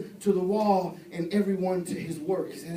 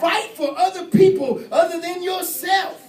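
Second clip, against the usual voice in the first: loud, high scripture cry; preaching shout; 8 semitones higher, 8 dB louder than usual. Acted. Preacher quoting the verse to his church, loud shout.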